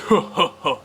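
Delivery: high, loud mock cackle of the friends; imitated mocking laugh. Imitating laughing friends, a loud mocking ha ha ha. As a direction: ape their laughing at you. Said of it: "That's what they sounded like".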